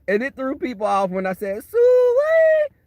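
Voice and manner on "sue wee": high, loud hollered pig call; country hog call. Retold or imitated. Calling the pigs in from the woods with a high yell. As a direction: holler it across the farmyard to the pigs. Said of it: "That's what country people say".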